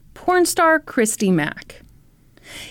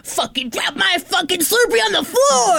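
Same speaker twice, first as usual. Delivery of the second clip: high-pitched mock outraged shout; mock tantrum; 7 semitones higher than usual. Mocking the ranter's boycott threat, tantrum yell. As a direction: throw a pretend fit.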